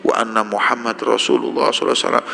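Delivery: quick, fluent Arabic recitation; reciting the shahada. Acted. Blind man instantly declaring his faith, brisk recitation. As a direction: rattle off the creed in one breath. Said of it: "langsung syahadat".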